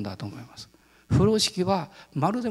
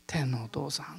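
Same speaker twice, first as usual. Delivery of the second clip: unvoiced whisper to God; whispered prayer; 2 semitones lower, 9 dB quieter than usual. His spirit calling to the heavenly Father, whispered. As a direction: breathe it as an intimate whisper.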